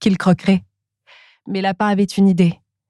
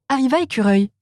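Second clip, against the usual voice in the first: plain reading voice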